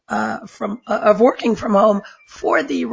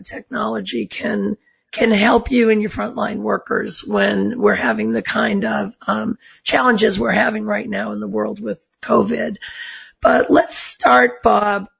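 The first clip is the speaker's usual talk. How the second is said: ordinary talk, nothing marked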